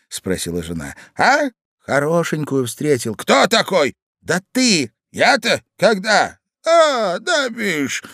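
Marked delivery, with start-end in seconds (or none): 1.2-8.0 high, loud, bewildered exchanges; short high questions, then sudden recall; forgetful old husband. A narrator's reading. Old man puzzled by his wife's questions, high and loud.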